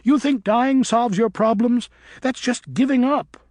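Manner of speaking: put-on girl bat scolding him mid-fight; furious scolding. A narrator's reading. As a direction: throw it at him as contempt. Luna scolding Griffin.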